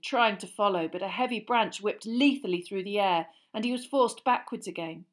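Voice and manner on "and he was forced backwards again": usual speaking voice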